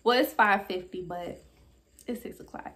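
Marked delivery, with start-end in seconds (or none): 2.1-2.7 breathy hushed aside about rounding up the hour; whispered aside. Shown as a demonstration. To her viewers, a hushed joking aside. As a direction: drop to a whisper for the joke.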